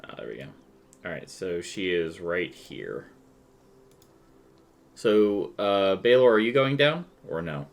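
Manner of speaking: usual speaking voice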